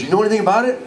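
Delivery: high, excited question; excited to find a fellow fan; eager question. Retold or imitated. Guide eagerly asks back.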